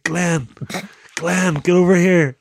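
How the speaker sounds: loud, high call across the ground; calling out in pain and alarm; shouting for Glenn. Retold or imitated. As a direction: yell for your mate to come over.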